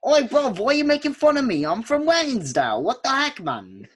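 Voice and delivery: high, loud hammed-up British lad; mock British accent. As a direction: play an offended British bloke from Wellingsdale. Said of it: "if that's actually a town".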